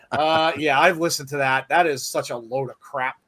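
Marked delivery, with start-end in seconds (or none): none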